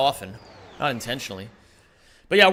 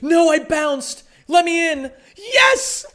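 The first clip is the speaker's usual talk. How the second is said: high, loud yelps building to a shriek; frantic excitement; 11 semitones higher, 7 dB louder, slower than usual. Real, not acted. Streamer begging the game to let them in, real frantic yelling. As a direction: cry out desperately, climbing into a triumphant scream. Frantic gameplay moment, then triumph.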